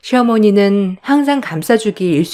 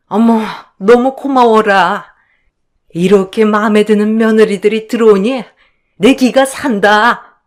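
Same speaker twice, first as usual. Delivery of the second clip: delighted mother-in-law. Acted. Mother-in-law thanks her favored daughters-in-law, delighted gushing. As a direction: savor being pampered by the favorites.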